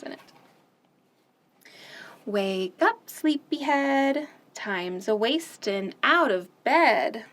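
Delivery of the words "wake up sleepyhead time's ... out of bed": plain reading voice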